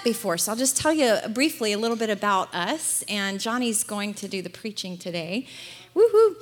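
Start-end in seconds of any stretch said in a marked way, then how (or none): 6.0-6.4 high excited whoop; cheering woohoo. Real, not acted. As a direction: cheer him on like a fan. Cheering husband's upcoming preaching.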